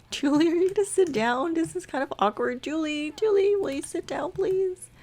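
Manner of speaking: high-pitched, coaxing request; awkward pleading. Acted. Asking her friend to sit down, awkward high-pitched pleading.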